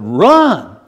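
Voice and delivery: urgent angel shouting run; angel's urgent command. Acted. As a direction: order them to flee, loud then drawn out. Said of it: "they said".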